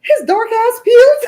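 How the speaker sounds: high, slow, gleeful tease; teasing. Real, not acted. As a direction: stretch it out to roast him. Teasing friend about peeling skin.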